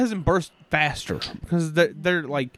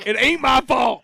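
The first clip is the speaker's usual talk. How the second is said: defensive protest; 8 semitones higher, 6 dB louder than usual. Real, not acted. Laughing, defensive repeat.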